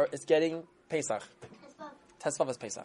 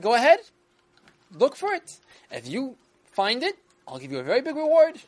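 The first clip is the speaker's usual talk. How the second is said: king; 9 semitones higher, 7 dB louder than usual. Acted. King answers Avram.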